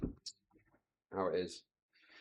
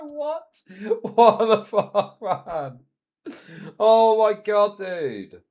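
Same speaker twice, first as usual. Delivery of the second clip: slow, much higher disbelieving laugh; mock shocked; 7 semitones higher, 8 dB louder, much slower than usual. To viewers, replaying his stunned reaction, high and slow.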